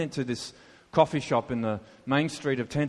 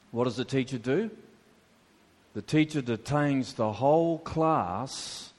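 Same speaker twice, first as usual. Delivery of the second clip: ordinary talk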